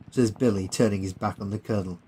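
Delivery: plain reading voice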